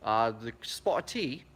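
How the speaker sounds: slow, put-on British accent; clumsy fake British accent; mock British accent. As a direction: try a British accent and fumble it. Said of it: "can I do a British accent?".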